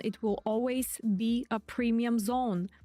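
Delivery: usual speaking voice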